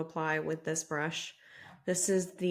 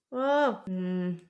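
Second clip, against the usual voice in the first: ordinary delivery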